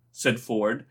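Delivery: plain reading voice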